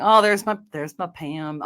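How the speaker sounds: ordinary talk, nothing marked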